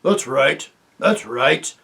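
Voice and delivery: a loud growl from the dwarfs; growled agreement. Acted. The other dwarves back Griffle with a growl. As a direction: grumble it as a pack of dwarfs. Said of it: "growled the other dwarves".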